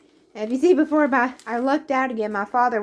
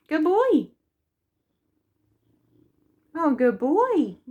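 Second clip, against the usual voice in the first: pet talk. Real, not acted. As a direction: fuss over a purring pet. Cuddling her purring cat.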